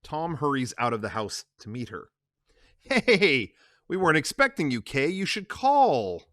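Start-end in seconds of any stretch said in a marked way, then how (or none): 2.8-6.2 lightly voiced, slightly raised and guarded; a hint of a worried Tom. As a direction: Tom caught off guard by Kay's visit. Tom greets Kay at gate.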